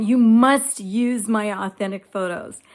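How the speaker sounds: loud, insistent retold order, stressing "must"; fighting demand. Woman retelling her fights with Chat GPT, loud and insistent. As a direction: replay your side of a fight, loudly and firmly. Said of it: "fighting. I'd be having fights".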